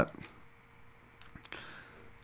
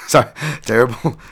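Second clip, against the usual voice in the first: usual speaking voice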